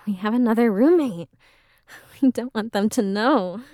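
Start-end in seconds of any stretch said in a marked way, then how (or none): none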